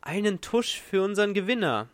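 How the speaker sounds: lightly voiced club manager on the PA; manager over loudspeaker. A narrator's reading. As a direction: call out a flourish for the winner. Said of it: "sonore Organ des Geschäftsführers".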